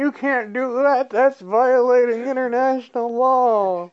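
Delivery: high, loud whiny objection; whiny sourpuss. Retold or imitated. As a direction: nag like a petty rule-stickler. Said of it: "the saddest sourpusses ever".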